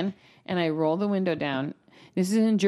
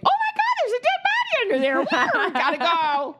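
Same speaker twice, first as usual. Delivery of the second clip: fake shocked shriek. Fleeing driver pretending to discover the body, shrieking high. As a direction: shriek it like a hammy bad actor. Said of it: "be like".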